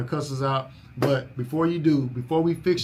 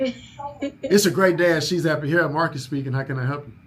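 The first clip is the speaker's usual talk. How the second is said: ordinary talk, nothing marked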